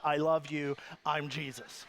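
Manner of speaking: serene Jesus voice. Retold or imitated. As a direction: play a gentle, pious Jesus. Brother playing Jesus, quoted.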